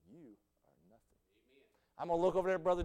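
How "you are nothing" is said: hushed whisper. Pretend. Preacher imagining telling Brother Keith in heaven, hushed. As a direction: breathe it gently as a secret. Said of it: "I'm gonna say".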